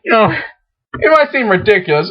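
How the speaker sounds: ordinary delivery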